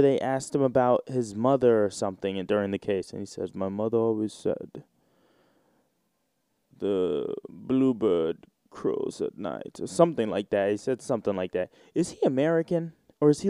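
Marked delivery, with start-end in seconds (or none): 3.5-9.8 slow, lightly British actorly quote; a hint of British accent, drawn out and lower at the end; Johnny Depp. Retelling an actor's answer during the case, mildly British. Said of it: "seem so British whenever he talks".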